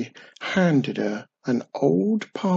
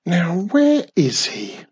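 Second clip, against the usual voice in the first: slow, higher-pitched grumble, a touch put out; mild complaint; 5 semitones higher, 4 dB louder than usual. A narrator's reading. Witch searching for her hidden cousin, mildly complaining. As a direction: complain with drawn-out words. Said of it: "she complained".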